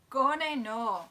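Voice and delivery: mock Scottish accent; Scottish accent with a laugh. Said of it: "because it's a Scottish comedy".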